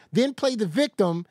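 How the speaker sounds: normal talking voice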